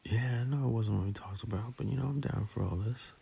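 soft intimate whisper. Acted. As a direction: whisper it close and tender. Intimate moment begins.

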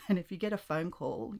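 normal talking voice

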